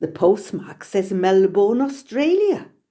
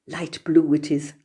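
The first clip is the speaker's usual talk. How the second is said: usual speaking voice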